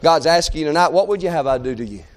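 very low, softened preacher's voice; hushed scripture reading. Acted. Preacher telling how Jesus called and healed them, hushed. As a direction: drop right down and let the verse sink in.

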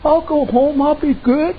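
high, slow, sheepish promise; meek husband. Retold or imitated. Relieved husband promising wife to behave, high meek. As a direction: promise like a chastened boy. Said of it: "He said".

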